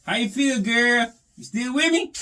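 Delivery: coaxing the bass. Acted. Talking to his bass.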